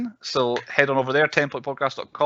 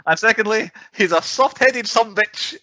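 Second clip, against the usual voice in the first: put-on old-timey Southern accent; 7 semitones higher, 3 dB louder than usual. Host teasing co-host in Mississippi accent. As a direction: insult him in a folksy Southern twang. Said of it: "every time that the guy says sumbitch".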